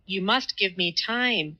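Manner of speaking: ordinary delivery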